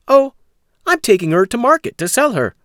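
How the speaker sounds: put-on high, bright young Jack; polite boy Jack. Jack telling the stranger his errand, high and polite. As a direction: answer like an eager polite boy.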